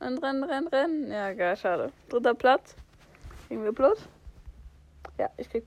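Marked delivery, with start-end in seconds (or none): 0.1-1.1 frantic shouting. Real, not acted. Frantic instructions mid-fight.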